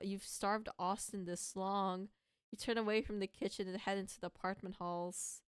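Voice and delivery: normal talking voice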